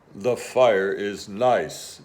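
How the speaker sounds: low, slow bear voice; bear announcing. Acted. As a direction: announce it slowly, deep like a bear. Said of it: "Bear announced".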